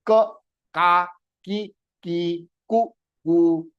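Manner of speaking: ka ki ku each said twice, clearly; syllable drill. Shown as a demonstration. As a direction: drill the vowel sounds. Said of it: "sounds started developing".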